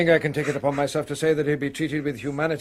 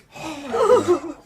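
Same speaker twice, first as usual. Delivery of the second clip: shocked gasp; 14 semitones higher than usual. Acted. Shock on seeing the wounded hand.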